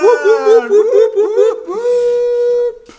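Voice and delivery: loud high party whoops; over-the-top celebratory whoops; whooping cheer. Real, not acted. Guest cheering his own entrance.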